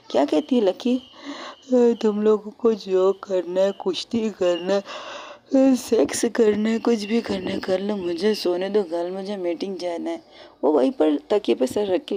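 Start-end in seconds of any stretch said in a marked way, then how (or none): 1.7-10.2 sleepy drawl. Acted. Sleepy girl wants to sleep.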